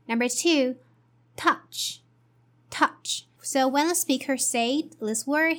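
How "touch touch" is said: "touch" hit hard, clipped and breathy; stressed touch. Shown as a demonstration. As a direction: punch the word "touch". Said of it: "he stresses this word to emphasize".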